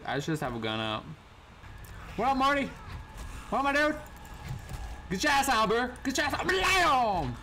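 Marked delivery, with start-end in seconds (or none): 2.2-7.3 hyped taunts. Real, not acted. Taunting enemies while shooting.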